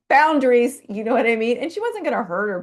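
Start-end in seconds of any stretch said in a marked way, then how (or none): 0.1-0.8 loud, high punch on Boundaries; emphatic. Real, not acted. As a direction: hit Boundaries hard and high. Punchline of lion story.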